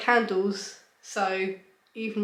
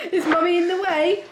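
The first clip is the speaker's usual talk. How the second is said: laughing; 8 semitones higher, 5 dB louder than usual. Real, not acted. Laughing with the baby.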